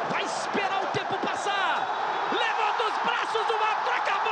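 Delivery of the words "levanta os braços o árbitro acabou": final whistle shout. Real, not acted. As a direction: announce the title win.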